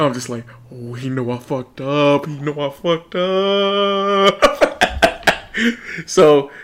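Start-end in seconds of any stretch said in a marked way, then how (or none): 0.7-4.6 slow, drawn-out dread; imitating own panic when the waiter comes; mock panic. Young man mocking his own dread at the waiter's check-in.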